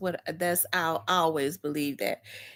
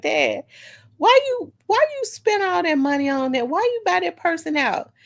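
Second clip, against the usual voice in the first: incredulous; 9 semitones higher than usual. Retold or imitated. Daughter confronting father about spending.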